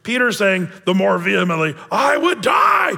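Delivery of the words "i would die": high, loud and slow protest; intense vow. Acted. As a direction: swear it with all your might. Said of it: "you can almost hear an intensity here".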